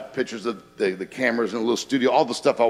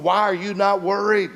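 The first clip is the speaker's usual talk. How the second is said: higher, panicky castaway pleading; freaking out; 9 semitones higher than usual. Retold or imitated. Stranded castaway begs his calm friend to act, panicky and higher. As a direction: sound like a stranded guy losing it. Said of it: "one of them was freaking out".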